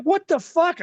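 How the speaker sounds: high shocked shout; shocked yell. Retold or imitated. Retelling the wrestler's sneak attack, shocked high yell. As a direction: yelp it in disbelief.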